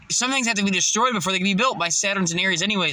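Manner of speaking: normal talking voice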